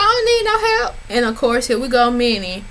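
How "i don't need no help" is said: mock Juicy's proud refusal; high mocking imitation of Juicy; mimicking Juicy. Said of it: "But she was like".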